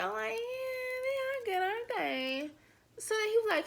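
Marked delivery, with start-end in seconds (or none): 0.3-2.5 high, sweet, cutesy tone; high, soft, fake-sweet flirting. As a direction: put on a dainty date voice. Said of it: "I'm trying to be all cute".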